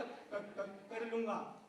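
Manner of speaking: low soft stutter on क-; stammering friend. Retold or imitated. Stammering friend insisting he can do it. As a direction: stick on क- then finish. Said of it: "बोला".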